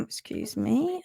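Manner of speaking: ordinary talk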